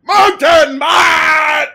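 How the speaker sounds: high, loud shouted character voice; shouting. Acted. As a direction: bellow it out, drawn long. Character bellowing in the scene.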